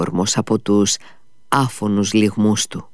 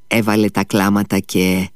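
plain reading voice

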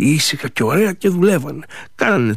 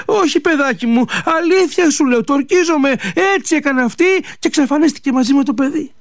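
much higher, insisting she tells the truth; an old woman swearing, higher and quicker at the end; pleading old mother; 8 semitones higher than usual. Acted. Mother swearing innocence to her son, high pleading voice.

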